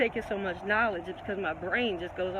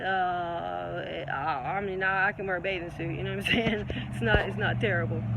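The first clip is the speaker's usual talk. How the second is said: ordinary talk, nothing marked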